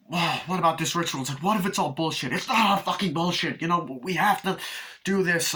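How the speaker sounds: high, agitated back-and-forth; acted bickering. Family arguing over the ritual, acted bickering. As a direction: imitate a heated family squabble.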